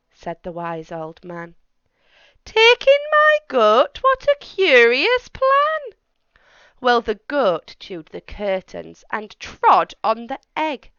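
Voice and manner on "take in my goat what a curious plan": much higher, louder incredulous squeal; shocked at the odd plan; astonished old lady. Acted. Old lady reacting to the wise man, high astonished squeal.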